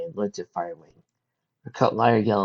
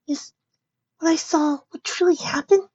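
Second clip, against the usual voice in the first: put-on young filly voice. Fireside's voice.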